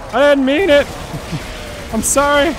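high, loud panicked yell; panicked shout. Real, not acted. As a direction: cry out in guilty panic. Accidentally killed creature.

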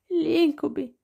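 high, tear-choked voice; tearful. A narrator's reading. Noah answering Nick while crying, tearful. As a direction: say it through tears.

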